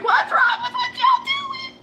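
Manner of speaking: very high, loud disbelieving squeal; a squeaky, loud cry at everyone. Retold or imitated. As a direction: shriek the question in mock outrage. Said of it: "and I'm going".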